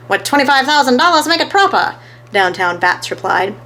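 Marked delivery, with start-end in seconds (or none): 0.1-1.9 fast, loud, higher hustler voice; gruff gambler. A narrator's reading. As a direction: rattle it off like a bookie.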